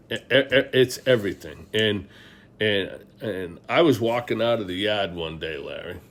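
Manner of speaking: ordinary delivery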